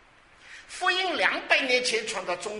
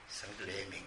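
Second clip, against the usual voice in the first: very soft and low; hushed repeat; 10 semitones lower, 14 dB quieter, much faster than usual. Real, not acted. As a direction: let it sink to a low, reverent hush. Hushed repeat of same words. (低声轻轻地说)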